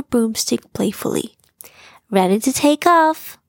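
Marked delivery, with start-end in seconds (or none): 2.1-3.4 higher and louder, eager; declaring eagerly. Acted. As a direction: announce it with excitement. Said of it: "she declared".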